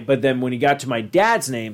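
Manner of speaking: usual speaking voice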